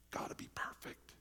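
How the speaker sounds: whispered inner voice. Retold or imitated. Preacher voicing the perfectionist's inner nag, whispered. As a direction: hush it like a nagging thought.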